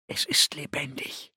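breathless awed whisper. Acted. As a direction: whisper it like a secret. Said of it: "hatte er geflüstert". (geflüstert)

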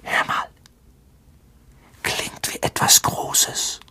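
slow, hushed whisper. A narrator's reading. Harry to Ron, listening for something big, whispered. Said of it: "flüsterte Harry".

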